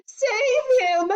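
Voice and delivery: high, panicked scream for help; frantic mother's cry. A narrator's reading. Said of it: "screamed Missus Globe".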